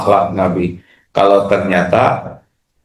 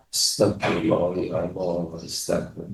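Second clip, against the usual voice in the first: low, soft murmured prayer; hushed istighfar; 4 semitones lower, 13 dB quieter than usual. Shown as a demonstration. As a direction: breathe the words under your breath. (istigfar lirih dan pelan)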